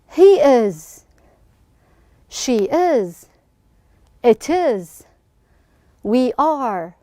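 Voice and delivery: short pairs of words with long pauses; slow, measured grammar recitation; read-along drill. Shown as a demonstration. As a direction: lead a class through it, pausing for repeats. Teacher leads class reading together.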